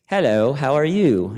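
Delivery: put-on amateur British accent for a late wife's hello. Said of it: "I'm trying to get the accent down".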